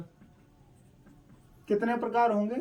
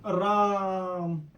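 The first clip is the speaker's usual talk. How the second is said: drawn-out name; much slower than usual. Shown as a demonstration. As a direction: stretch राम slowly as a call. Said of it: "राम ऐसे करके बुलाते हो".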